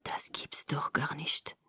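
slow, very soft whisper; barely audible. A narrator's reading. Laurent stunned by what he sees, barely audible whisper. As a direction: whisper it in disbelief, almost too low to catch. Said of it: "sagte er so leise, dass ich ihn kaum verstand".

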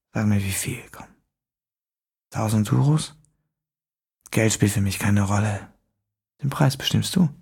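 leise, raunende Fremdenstimme; düster und gedämpft aus dem Schatten; unheimlicher Fremder. A narrator's reading. Fremder spricht. (geheimnisvoller Fremder im Dunkeln, leise raunend)

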